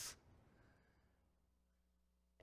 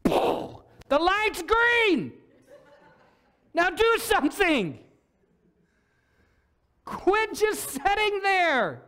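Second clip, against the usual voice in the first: high, loud and slow, stretched out urgently; exasperated call; 11 semitones higher, 6 dB louder, much slower than usual. Pretend. God calling to the stalled church, loud and exasperated. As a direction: jolt them awake, stretch each word. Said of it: "God ever wants to look at the church and just go".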